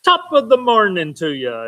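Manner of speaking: hammy stage Irish greeting; cheesy fake Irish lilt; bad Irish accent. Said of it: "in bad Irish accent".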